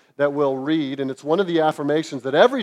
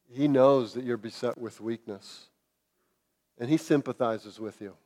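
ordinary talk

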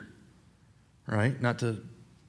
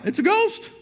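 high, startled shout of fear; frightened cry. Retold or imitated. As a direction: cry out as if seeing a ghost. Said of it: "they cry out".

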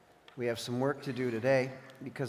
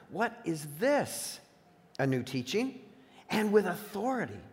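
amazed; 5 semitones higher than usual. A narrator's reading. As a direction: ask it in mild astonishment. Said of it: "all so amazed that they asked each other".

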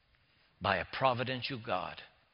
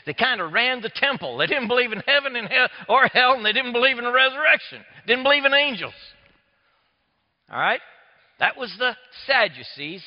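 louder, driving sermon voice; preaching; 7 semitones higher, 9 dB louder than usual. Acted. Preacher explains the Sadducees to his congregation, driving preaching.

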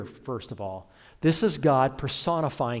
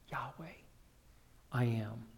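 hushed reverence; 2 semitones lower, 13 dB quieter than usual. Real, not acted. Preacher giving the congregation God's name and its meaning, hushed. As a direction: drop right down, almost under the breath.

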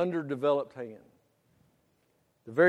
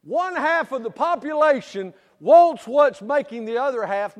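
full-force preaching; 10 semitones higher, 12 dB louder than usual. Acted. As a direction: preach it big to the congregation, building. Preaching Solomon's story at full volume.

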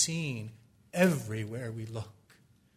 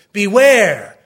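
climactic warning; 8 semitones higher, 20 dB louder than usual. Acted.

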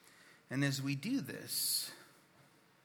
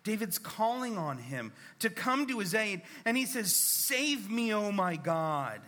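rising fervour; 9 semitones higher, 6 dB louder than usual. Real, not acted. Preacher rising to climax.

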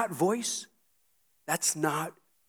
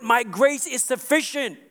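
higher, louder preaching of God's words; God's voice, preached loud; 8 semitones higher, 5 dB louder than usual. Acted. Preacher voicing God to the congregation, loud proclaiming. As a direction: proclaim it from the pulpit. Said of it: "It's a voice that says".

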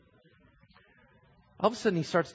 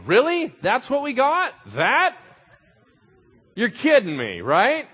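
high, loud mocking disbelief; incredulous crowd; 11 semitones higher, 9 dB louder, slower than usual. Crowd scoffing at the baby Messiah: high, loud disbelief. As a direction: scoff at what God sent.

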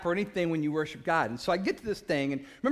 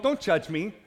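ordinary talk, nothing marked

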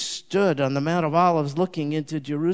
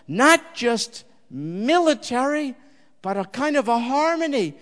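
high, loud preacher building to a cry; fervent preaching; 6 semitones higher, 5 dB louder than usual. Acted. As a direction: rouse the congregation, raise the voice. Preaching at full force.

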